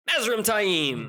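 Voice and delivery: high, slow mock-dramatic announcement; drawn-out cliffhanger name drop; dramatic reveal. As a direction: announce the villain's arrival with hammed-up suspense. Mock-dramatic reveal before a sting.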